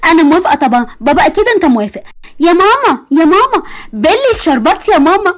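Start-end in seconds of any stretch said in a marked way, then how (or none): 2.4-5.3 high, eager call to mom; calling out excitedly. Acted. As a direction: shout to mom with joy. (نداء فرحان عالي للأم)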